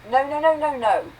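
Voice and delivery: high, loud panicky protest; a mild burst of panic at the bathroom idea; panicked no. A narrator's reading. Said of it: "immediate panic".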